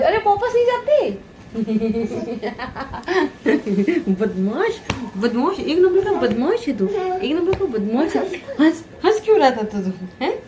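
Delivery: very high, laughing coo. Real, not acted. Delighted cooing at laughing baby.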